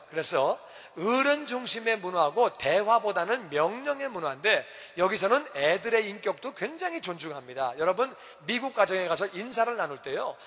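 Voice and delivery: normal talking voice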